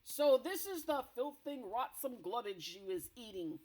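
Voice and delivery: loud, scornful giant boom. Acted. Blood Bottler mocking the BFG's supper, booming loud. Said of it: "boomed the Blood Bottler".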